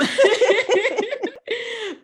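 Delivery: high loud burst of laughter; amused laughter; bright laugh. Real, not acted. Laughing at herself.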